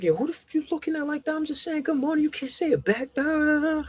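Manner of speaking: hammed-up affronted retelling; mock indignant. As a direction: act out being insulted by a stranger. Retelling indignant outburst at a stranger.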